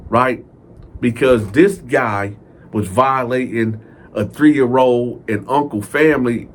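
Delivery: ordinary talk, nothing marked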